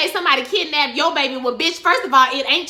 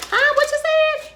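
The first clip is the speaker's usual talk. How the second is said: incredulous; 14 semitones higher than usual. Retold or imitated. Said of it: "And he was like".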